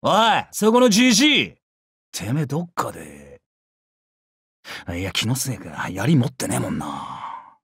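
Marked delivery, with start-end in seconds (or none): 2.1-7.6 low, hushed musing; 独り言のような疑い. Acted. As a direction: drop low and trail into doubt. Half recognises old man, muses. (低く抑えた声でいぶかしむ)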